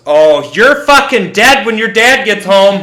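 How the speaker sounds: high, loud angry yell; furious mom. Retold or imitated. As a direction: yell it like an enraged mother. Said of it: "all I hear my mom go is".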